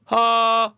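high, loud held "haa"; loud test tone. Shown as a demonstration. Host checking which sound distorts, loud held note. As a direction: belt one sustained test note. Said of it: "この音割れるね".

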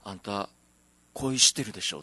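lightly imitated sassy onee talk; a hint of camp in a retold remark. Retelling an older man's remark at a snack bar, lightly camp. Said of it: "その喋り方もなんかお姉みたいですね".

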